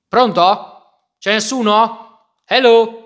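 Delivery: playful loud hello down the line; calling out. Pretend. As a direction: call into the phone as if nobody's there. Answering an in-game phone.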